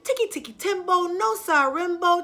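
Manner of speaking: high, loud urgent call; shouting. Acted. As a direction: shout to wake the old man. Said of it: "shouted Chang".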